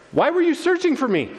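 high-pitched baffled question; confused. Acted. Twelve-year-old Jesus to his searching parents, confused. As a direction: ask it in puzzled disbelief, pitched well up. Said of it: "Jesus is the one that's confused now".

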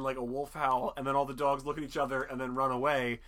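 ordinary talk, nothing marked

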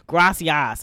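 mock a Spanish word in an Oklahoma accent. Daughter imitating her mom learning Spanish, mock Oklahoma accent. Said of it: "will say it in Oklahoma accent".

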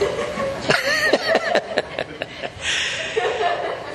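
high wheezy laugh. Real, not acted. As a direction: laugh helplessly at your own admission. Joking aside about the wife.

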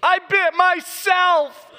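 put-on high, slow tiny-girl voice; little girl confession. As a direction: confess sheepishly like a small child. Daughter confesses.

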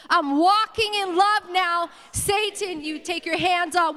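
high, loud declaration with drawn-out words; like you mean it. Shown as a demonstration. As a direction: proclaim it with full conviction. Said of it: "Say it like you mean it".

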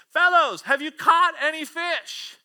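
high, loud hail across the water; calling out. Acted. Jesus calls to the disciples from shore, calling out. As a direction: shout it like a call from shore. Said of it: "he called out".

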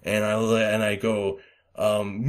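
ordinary talk

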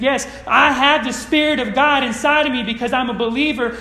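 ordinary talk

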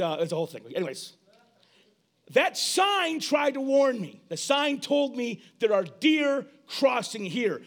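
normal talking voice

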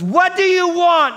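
very high, loud shouted demand; mock shout. Socrates shouting at the gasping kid. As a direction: bellow the question at him.

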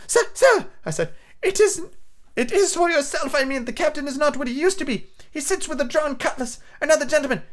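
put-on high, pleading boy; young boy voice. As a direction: beg him anxiously as a lad.

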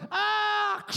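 high loud hammed-up shriek; mock push scream. Preacher imitating wife's last push. Wife pushing in delivery.

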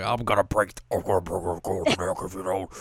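slow, garbled monster voice; garbled demon. Retold or imitated. Demon threatening the runaways, slow garbled creature voice. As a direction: gargle the words like a demon.

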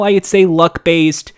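ordinary delivery

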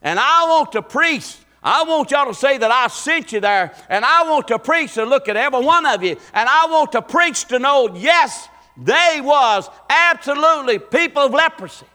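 high, loud preaching voice as Jesus giving orders; voicing Jesus. Preacher voicing Jesus to the ten lepers, loud and high. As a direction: proclaim it like Jesus sending the lepers off. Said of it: "Jesus, like".

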